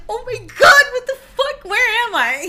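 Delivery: high-pitched retold panic, loud at first; freaking out. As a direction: re-enact waking up in total alarm. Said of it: "freaking out".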